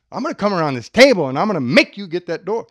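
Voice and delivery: loud, fast, hammed-up threat; mock angry threat. Shown as a demonstration. Threatening Mike over the door, mock fury. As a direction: storm at him like you'll make him.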